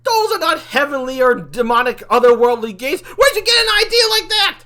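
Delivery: loud, high-pitched incredulous rant; incredulous mockery. Demon mocking angel friend's game idea, incredulous shouting.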